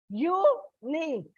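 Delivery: "unique" slowly split in two; syllable by syllable. Shown as a demonstration. Teacher demonstrating the word ending to children, "unique" slowly broken into syllables. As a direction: say "unique" as u, then nique, spaced apart. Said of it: "When you pronounce it is".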